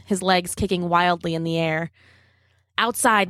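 plain reading voice

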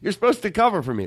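a pretend rant at the backing band; mock yell at the band. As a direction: scold the band for your own missed note. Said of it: "I would yell at them".

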